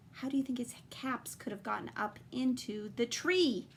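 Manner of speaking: ordinary delivery, nothing marked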